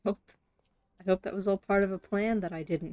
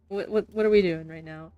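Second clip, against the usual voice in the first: ordinary delivery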